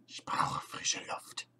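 slow, breathless whisper; faint whisper. Acted. Creature whispering weakly behind the door. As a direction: barely whisper it, slowly.

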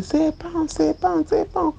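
high repeated cry of alarm; retold scream. Girl calling out a snake warning, high cry. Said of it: "j'ai commencé à crier".

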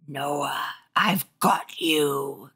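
slow, raspy evil whisper; deep raspy evil. Acted. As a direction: growl it like a masked monster. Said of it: "her voice deep, raspy, evil".

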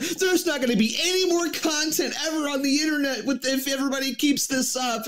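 high, whiny mock panic; mocking hysterical doom voice; hyperbolic whiner. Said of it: "everyone's like".

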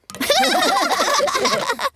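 quick amused laugh; light amused laugh. Real, not acted. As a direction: laugh at the pretend game. Laughing at the pretend mountain.

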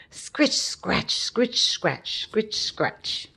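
breathy high scritch-scratch sounds; scratchy digging noise. Acted. Narrator imitating puppy digging, scratchy breathy sounds. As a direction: rasp it out like paws digging.

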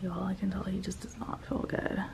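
hushed unvoiced whisper. Real, not acted. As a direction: whisper in a waiting room. Whispering in doctor's room.

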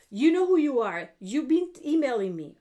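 loud, pointed call-out. Real, not acted. Calling out the viewer who has been emailing her, loud and pointed.

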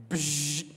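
short buzzing mouth noise; imitated window buzz. Narrator imitating the power window going down, buzzing. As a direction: make the power window sound. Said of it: "I simply do this".

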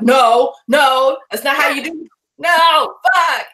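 loud, high exasperated shouting; mock yell at TV. Ranting at a medical show, shouting no. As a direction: yell at the screen like the show is wrong.

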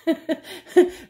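high burst of laughter at her own joke; a quick high giggle; embarrassed laugh. Real, not acted. Joke about being robbed.

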